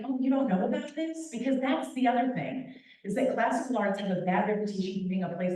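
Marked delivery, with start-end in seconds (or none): 0.0-1.3 higher-pitched, condescending question; feigned patronizing disbelief; mock condescension. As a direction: imitate someone talking down to a newcomer. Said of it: "not condescending, not like".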